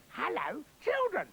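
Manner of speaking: high, sweet put-on mother voice; wolf nailing his high nice voice. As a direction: coo it sweetly. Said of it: "that's it".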